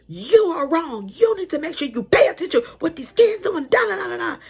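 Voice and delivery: high, loud furious rant at the teacher; angry mom yelling. Retold or imitated. Angry mom storming in, yelling at the teacher. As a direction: tear into her like an outraged parent. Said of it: "pointing at her like".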